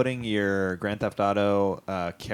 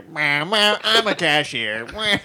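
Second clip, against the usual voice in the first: silly cashier voice; 7 semitones higher than usual. Retold or imitated. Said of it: "you do that in the booth".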